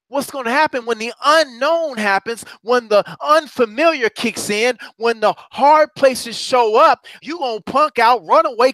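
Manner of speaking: rising fervent preach. Acted. Preacher warning the congregation about losing faith, fervent. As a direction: drive it home to the crowd.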